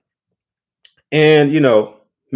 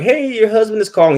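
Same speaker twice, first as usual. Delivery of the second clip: put-on high, pleading old man on voicemail; imitating a lovesick old husband; mock old husband; 10 semitones higher than usual. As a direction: put on a frail, doting old man's voice.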